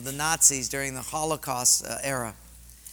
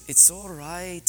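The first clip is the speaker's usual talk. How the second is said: put-on slow, higher-pitched German-accented reassurance; 5 semitones higher, slower than usual. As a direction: imitate a dying woman's German-accented comfort. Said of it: "she said in her German accent".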